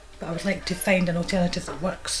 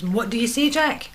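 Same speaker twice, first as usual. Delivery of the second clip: mock stern demand; 5 semitones higher, 5 dB louder than usual. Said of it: "And John says".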